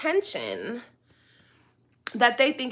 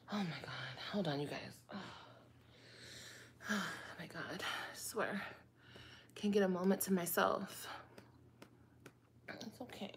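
much softer, whispered grumbling; exasperated whisper; 2 semitones lower, 12 dB quieter than usual. Pretend. Stepping away from the baby, softer whispered complaint. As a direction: grumble under your breath so baby sleeps.

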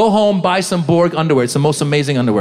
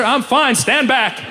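queasy insistence; 6 semitones higher, slower than usual. Acted. Sick passenger wards off stewardess.

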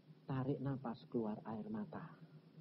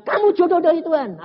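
high, loud romantic vow; mock grand declaration; 14 semitones higher, 10 dB louder than usual. Preacher quotes his own vow to a sweetheart, grandly. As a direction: declare it with overblown conviction.

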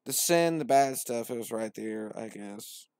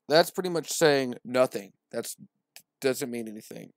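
ordinary talk, nothing marked

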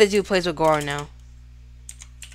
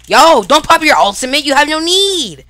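loud protest; 7 semitones higher, 6 dB louder than usual. Real, not acted. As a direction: cry out in protest at the opponent. Protests opponent's ultimate.

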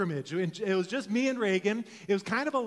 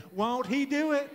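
higher-pitched put-on Texas twang; Texas accent; 6 semitones higher than usual. Shown as a demonstration. Preacher getting everybody to say it, Texas accent. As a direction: lead the crowd in a Texan accent. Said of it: "let's say it with a Texas accent".

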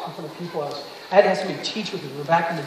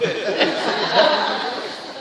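breathy laugh; 3 semitones higher, 6 dB louder than usual. Real, not acted. Laughs at own reply.

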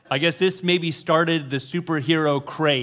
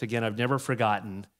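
ordinary talk

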